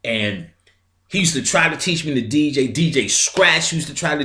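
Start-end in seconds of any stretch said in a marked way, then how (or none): none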